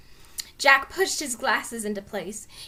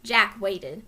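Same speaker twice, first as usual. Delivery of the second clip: plain reading voice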